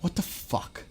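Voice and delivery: whispered under the breath in disbelief; stunned whisper. Real, not acted. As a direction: breathe it out, barely voiced. Stunned reaction, repeats the phrase.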